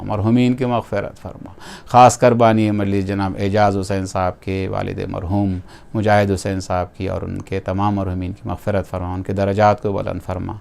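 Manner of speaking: low, soft, murmured prayer; closing supplication. Real, not acted. Preacher asking God's forgiveness for the deceased, soft low prayer. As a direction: pray quietly, letting each plea fall away.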